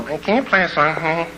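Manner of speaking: lightly mocking caller voice; request-line caller. Listener phoning the DJ for a song, mock caller voice. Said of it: "those ones you guys call".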